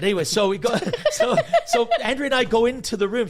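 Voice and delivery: pushing on through giggles; laughing. Real, not acted. Getting back to the story while still laughing. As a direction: still laughing, loud and high.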